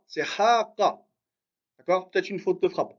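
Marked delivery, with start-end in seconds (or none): 0.2-1.0 "haqa" starting on "ha", first vowel held long; long "ha", short "qa" in "haqa"; corrected pronunciation. Shown as a demonstration. Teacher correcting a student's first letter, "haqa" with long "ha". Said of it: "c'est pas raqa".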